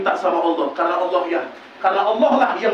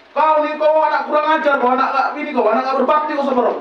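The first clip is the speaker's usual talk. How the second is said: mock angry scolding of a child; scolding mother; 4 semitones higher than usual. Mother scolding her child, mock angry tirade. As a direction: play the nagging mother berating her kid.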